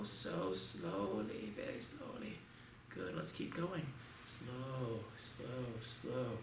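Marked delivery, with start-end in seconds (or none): 0.0-2.4 long drawn-out low so slowly, fading to a hush. Shown as a demonstration. Instructor slowing the class to a crawl. 4.2-6.4 soft, drawn-out slow, slow, slow; slow count. Shown as a demonstration. Instructor pacing students through slow blocks.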